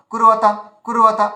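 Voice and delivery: কুরবাতা run together fast, no gap; quick short syllable. Shown as a demonstration. Teacher shows the short-vowel version, কুরবাতা rattled off in one piece. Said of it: "খুব স্পিডে বলতে হতো".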